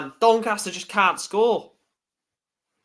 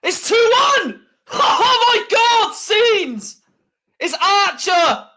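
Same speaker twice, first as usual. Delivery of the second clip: shouting much higher and louder with a laugh; ecstatic celebration; 9 semitones higher, 6 dB louder than usual. Real, not acted. Fan celebrating his team taking the lead, ecstatic shouting. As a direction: explode with joy at the winner.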